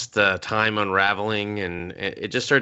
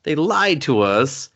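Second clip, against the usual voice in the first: slow, mock-indignant complaint; hammed-up slow protest, pitched up; imitating complainers; 5 semitones higher, slower than usual. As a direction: draw it out like a whining accusation. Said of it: "And they're like".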